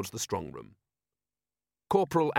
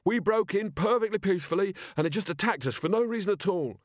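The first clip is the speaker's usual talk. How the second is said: higher-pitched, aggrieved protest; indignant shouting; 8 semitones higher than usual. A narrator's reading. Robber leader protesting about the animal attack, indignant. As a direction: complain indignantly, pitch raised, short of a full shout. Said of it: "he shouted".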